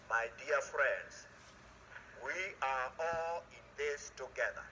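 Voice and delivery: a gentle closing plea to friends; sincere appeal. Real, not acted. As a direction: lean in and speak from the heart. Moral closing to the audience.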